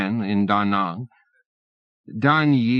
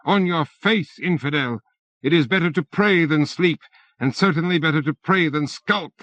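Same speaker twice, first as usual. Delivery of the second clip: indignant yell; 7 semitones higher than usual. A narrator's reading. Bureaucrat yelling at the fleeing stranger, indignant yell. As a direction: shame the infidel at full voice. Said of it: "Hussein yells".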